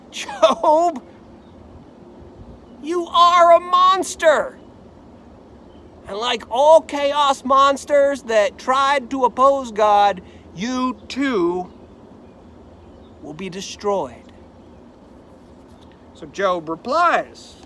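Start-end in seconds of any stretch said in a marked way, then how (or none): 0.1-4.6 high, loud denunciation; brand him a monster, full voice; preacher as Job's friend, loudly condemning him; condemning cry. Acted. 10.6-14.3 ominous hush. Acted. Final doom pronounced on Job.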